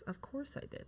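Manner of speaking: breathy whispered self-aside. Real, not acted. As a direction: whisper it to yourself. Self-aware aside about loving animals.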